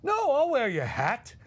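high, slow, sarcastic yes; mock-compliant fury. Imitating Dom agreeing through clenched fury. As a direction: fake sweetness over anger. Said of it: "he was furious".

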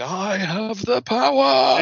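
slow, heroic, lifted high; heroic cry. Retold or imitated. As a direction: proclaim it like a cartoon hero holding a sword up. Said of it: "Both arms pointing in the air, going".

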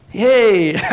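loud gleeful cheer; real excitement. Real, not acted. Preacher celebrates God's love before church, excited. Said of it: "Well, I'm excited".

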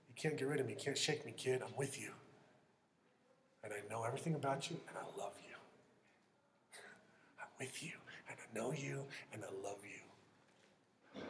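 very soft, low reassurance; God speaking tenderly. Acted. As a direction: drop to a hushed, intimate near-whisper. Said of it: "God says".

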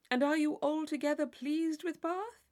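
high, loud, overly eager question; mock polite inquiry. A narrator's reading. Tilney ending his mock questions to Catherine. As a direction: put on the simpering partner.

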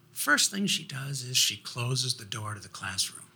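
ordinary delivery

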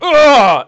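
loud high straining grunt; angry effortful ugh; ogre grunt of effort. Acted. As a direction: heave the mule with a roar. Ogre hurls burning mule.

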